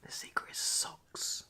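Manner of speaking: slow whispered reveal, socks last. Acted. Confiding the big secret to viewers, whispered. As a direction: hush and draw it out.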